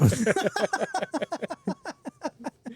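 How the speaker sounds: high-pitched, drawn-out giggling laugh; long laugh. Real, not acted. Reacting to a friend's joke about Russia, laughing. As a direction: crack up and let it trail on.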